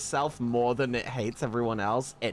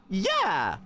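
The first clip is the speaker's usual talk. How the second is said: higher, falling eager exclamation; eager yes; 6 semitones higher, 4 dB louder than usual. Retold or imitated. Streamer accepting henna from the lady, eagerly reenacted. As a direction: reenact jumping at the offer. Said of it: "And I was like".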